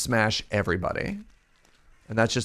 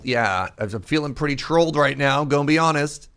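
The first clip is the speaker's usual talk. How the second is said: louder, fed-up complaint; exasperated; 5 semitones higher, 5 dB louder than usual. Real, not acted. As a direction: vent about being trolled. Frustrated at tough enemy.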